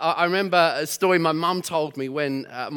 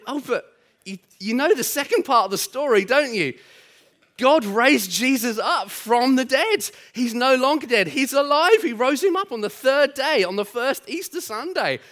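higher, reassuring Geordie grandmother; gently explaining Geordie grandma, higher; 8 semitones higher, 4 dB louder than usual. Retold or imitated. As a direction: comfort the child in a Geordie accent. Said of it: "butcher the Geordie accent".

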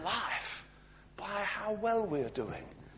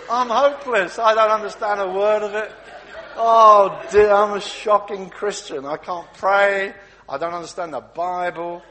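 loud, slow lament; hammed-up wail of failure; loud mock despair, dragged out; 3 semitones higher, 13 dB louder than usual.

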